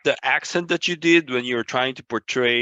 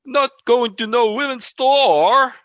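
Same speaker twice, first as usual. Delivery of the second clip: high, loud, stretched-out Southern accent; hammed-up slow Southern demo; overemphasized Southern accent; 11 semitones higher, 5 dB louder than usual. Shown as a demonstration. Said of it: "you could overemphasize that accent".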